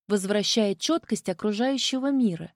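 plain reading voice

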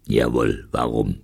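low, curt convict reply; gruff convict. A narrator's reading. Convict asked about the tin mine, gruff and curt. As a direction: answer curtly in a rough low voice.

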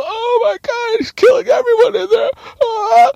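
very high, loud tearful wail; mock hysterical screaming. Lucky tells first responders of killings, mock hysterical screaming. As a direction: shriek in hammed-up terror. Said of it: "Lucky screaming like a".

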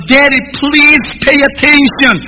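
little son insisting his father listen; pleading child. Retold or imitated. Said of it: "Junior de vuelta".

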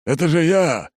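high, loud overblown pathos; hammy stage actor. A narrator's reading. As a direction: ham it up like a bad tragedian. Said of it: "непомерно пафосно произносит тот".

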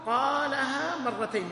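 قالها مرتين drawn out slowly, weight on قالها; slow emphatic phrase. Shown as a demonstration. Teacher giving students the alternative wording, slow and emphatic. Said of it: "أو تقول".

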